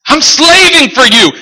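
high, loud whiny protest; the son's loud aggrieved protest; mock bitter complaint. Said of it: "the son's complaint repeated".

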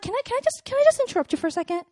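soft, high, hurried request; tentative interruption. Retold or imitated. Woman replays interrupting her date at dinner, tentative. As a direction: cut in meekly, starting high and small.

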